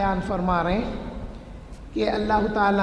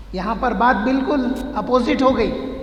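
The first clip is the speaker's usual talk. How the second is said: ordinary delivery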